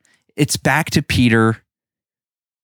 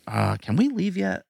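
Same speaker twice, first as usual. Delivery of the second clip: soft, higher-pitched mock grumble; imitated muttering; 7 semitones higher, 9 dB quieter than usual. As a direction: act out staff grumbling under their breath. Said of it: "muttering under their breath".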